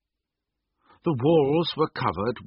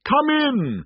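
booming cheerful giant call; cheerful spirit; 5 dB louder than usual. A narrator's reading. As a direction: call out warmly to a guest. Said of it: "called out cheerfully".

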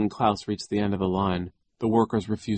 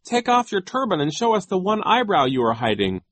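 high, pretend-playful call; mock-joking cry; 11 semitones higher, 4 dB louder than usual. A narrator's reading. Violet pretends to joke with Genghis, joking high cry. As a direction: say it high as if it's all a joke. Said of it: "as if she were joking".

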